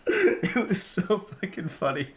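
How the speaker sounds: ordinary delivery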